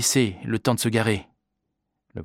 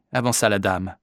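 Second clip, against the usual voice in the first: usual speaking voice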